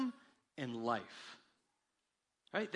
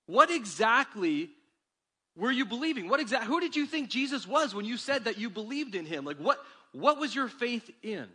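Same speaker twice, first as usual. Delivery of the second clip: pointed question; 6 semitones higher, 7 dB louder than usual. Real, not acted. Challenging hearers in sermon.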